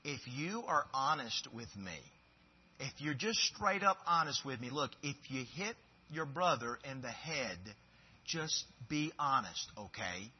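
put-on soft, patient coaxing of a child; a dad quietly coaxing his children to be honest; gentle fatherly plea. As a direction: talk softly to your kid. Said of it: "I'm saying, look, to my kids".

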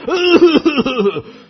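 loud bursting laugh; a real hearty laugh; big laugh. Real, not acted. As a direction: laugh out at the memory. Laughing at snoring man story.

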